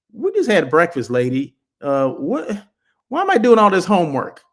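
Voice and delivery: hammed-up complaint about homework; mock protest. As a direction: push back like an overloaded pupil. Acted protest to demanding consultant.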